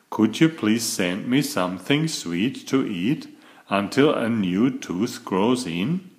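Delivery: usual speaking voice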